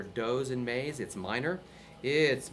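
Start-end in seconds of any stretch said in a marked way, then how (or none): none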